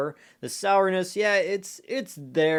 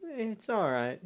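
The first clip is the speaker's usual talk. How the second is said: unimpressed shrug; 5 semitones higher, 9 dB quieter, slower than usual. Retold or imitated. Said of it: "we'll just be like".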